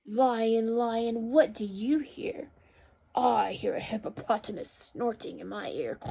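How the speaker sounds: measured, expressive question and answer; picture-book read-aloud. A narrator's reading. Teacher reads animal story to children, expressive storytelling. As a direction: read it out clearly for small children.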